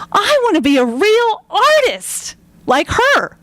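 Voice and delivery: put-on high, slow, yearning child voice; put-on little kid, pitched well up; twelve-year-old. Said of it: "that little twelve-year-old".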